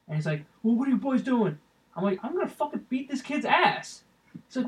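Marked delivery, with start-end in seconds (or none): 0.6-1.6 lightly voiced dad, pitched up; imitated dad. Dad coming over to two boys, imitated higher-pitched questioning. 2.2-4.0 high-pitched mock outrage; retold threat. Teen telling a dad about his kid, re-enacted high indignant threat.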